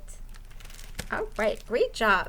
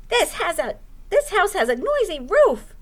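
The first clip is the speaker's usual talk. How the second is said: louder, higher owl yelling; mock yell; 7 semitones higher, 5 dB louder than usual. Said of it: "yelled Owl".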